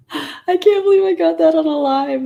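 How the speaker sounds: high, loud delighted outburst; excited squeal. Real, not acted. Cat kicked leg on camera.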